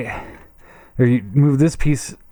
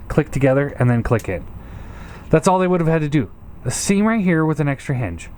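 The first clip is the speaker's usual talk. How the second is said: ordinary delivery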